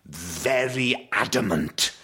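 slow, stately put-on posh Welsh voice; posh Welsh dad. Shown as a demonstration. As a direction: stretch the words with big swoops in pitch. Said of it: "he puts on a rather posh voice".

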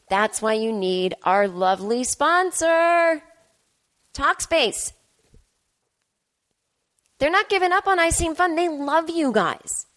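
upbeat podcast ad pitch, with some lines drawn out slowly; sponsor ad read. Acted. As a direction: sell the sponsor with playful enthusiasm. Reads sponsor ad for Talkspace.

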